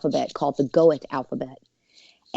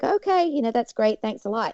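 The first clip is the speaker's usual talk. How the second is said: polite thanks; 7 semitones higher than usual. Retold or imitated. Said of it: "I'm like".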